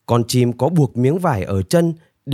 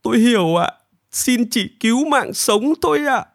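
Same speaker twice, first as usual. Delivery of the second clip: high, whimpering submission; pitched-up snivelling plea; tearful pleading; 11 semitones higher than usual. A narrator's reading. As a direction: beg meekly for your life. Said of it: "Nanpu khóc lóc mếu máo".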